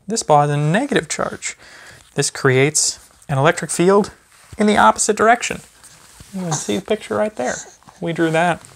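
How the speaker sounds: sing-song picture-book reading to a little one; gentle, lively storybook voice for a toddler. Acted. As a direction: read it like bedtime story time for a baby. Reads a baby book aloud.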